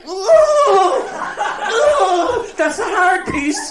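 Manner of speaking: very high, loud, slow hooting cry; shouted old woman. Retold or imitated. Old woman shouting back over the partition, very high and loud. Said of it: "shouts back".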